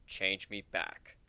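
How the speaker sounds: slow, low and firm demand; slow commanding voice, not to be argued with. A narrator's reading. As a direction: say it so it must be heard. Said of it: "Speaks in a voice that demands being heard".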